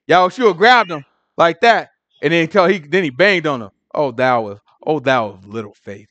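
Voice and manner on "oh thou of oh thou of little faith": low, soft solemn scripture voice; solemn rebuke. Retold or imitated. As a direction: lower the voice into old-Bible gravity. Yahushua scolding Peter.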